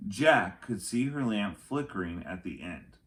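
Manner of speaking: ordinary delivery, nothing marked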